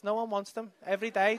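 ordinary talk, nothing marked

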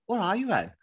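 imitating a baffled stranger, pitched high; baffled questioning. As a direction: ask in high-voiced, mock bewilderment. Stranger baffled after failed accent guesses.

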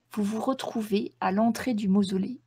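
ordinary talk, nothing marked

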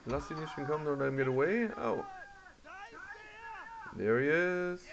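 ordinary talk, nothing marked

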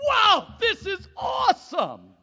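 high, loud, slow cry of wonder; overjoyed burst of awe; excited shout. Retold or imitated. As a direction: shout it like it's the best day ever. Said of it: "You gotta go".

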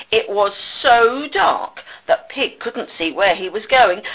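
usual speaking voice

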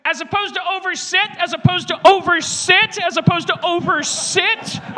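high, loud, insistent repetition; mock badgering. Comic badgering someone about a word choice, high and loud. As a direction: hammer the phrase out, high and shouty. Badgering bit, repeating a phrase loudly.

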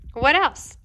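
話の続きをせかして; impatient. A narrator's reading. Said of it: "asked the fish impatiently".

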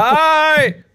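high, loud, stretched-out shout; yelled greeting. Real, not acted. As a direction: holler it to be heard. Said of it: "Why are you yelling?".

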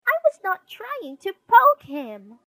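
high, loud indignant denial; shrill-pitched, shouted self-defence; sister protesting. Acted. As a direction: protest hotly in a little girl's voice. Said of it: "protested Sister".

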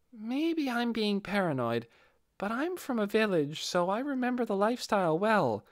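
put-on a sober, careful village boy thinking aloud; Ninja's voice. Ninja pointing out the clues to the group, high and careful.

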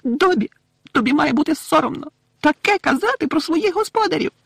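very high scolding elf voice; shaming him in a piercing elf pitch; spiteful squeaking elf. A narrator's reading. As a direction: squeal the reproach. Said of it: "злісно запищала Вінкі".

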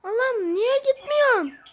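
high exasperated cry; frustrated yell at the game. Real, not acted. Ball won't go in.

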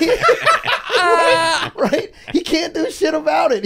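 high, loud and breaking up with laughter; can barely get it out for laughing; laughing hard. Real, not acted. Comedian laughing loudly at his own story to the host.

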